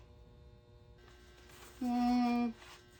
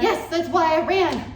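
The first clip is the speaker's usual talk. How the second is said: panicking; 5 semitones higher than usual. Acted. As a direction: blurt it out in a panic. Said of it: "Dude, you're panicking".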